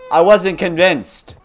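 spooked gamer exclaim; loud slow exclaim. Real, not acted. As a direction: say it big and slow. Reacting to spooky game event.

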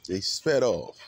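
usual speaking voice

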